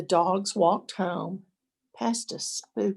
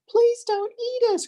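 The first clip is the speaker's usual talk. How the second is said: very high, louder pleading cry; terrified cry; 5 dB louder than usual. A narrator's reading. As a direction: beg the monster for your lives. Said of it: "cried the dogs".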